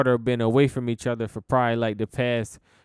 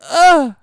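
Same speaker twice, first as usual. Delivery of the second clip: a loud, high, strained uh; imitated groan; 13 dB louder than usual. Imitating the tired friend's loud strained uh. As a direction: mimic a friend too tired to speak. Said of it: "And then he go".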